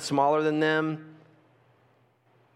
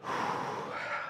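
exasperated sigh; 13 dB quieter than usual. Retold or imitated. Kid sighing before apology.

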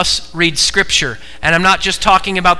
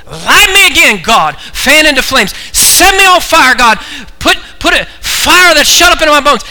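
loud, high cry to God; impassioned preaching prayer; 11 semitones higher, 9 dB louder than usual. Real, not acted. Preacher begging God to set him on fire, impassioned prayer. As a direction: build to a fervent full-force plea.

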